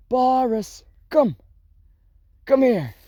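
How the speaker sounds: high, loud call of a name; calling the dog. Real, not acted. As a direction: call Boris over from a distance.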